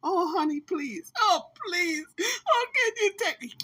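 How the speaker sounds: high pleading whimper, half laughing; hammed-up begging. Shown as a demonstration. TJ begs the woman to take him back, mock sobbing. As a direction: beg for him to take you back, overdone. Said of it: "You gotta ham it up".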